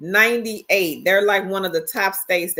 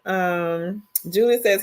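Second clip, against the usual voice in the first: ordinary talk, nothing marked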